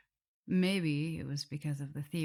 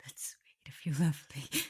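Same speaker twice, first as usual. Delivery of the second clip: touched whisper. Acted. Vampire shyly thanks her captive in a whisper. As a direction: murmur it as if embarrassed.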